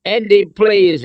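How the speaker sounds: high, loud, drawn-out plea; imploring. A narrator's reading. Captive woman to Andy at gunpoint, imploring. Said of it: "Elsa implored".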